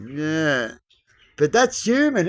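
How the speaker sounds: normal talking voice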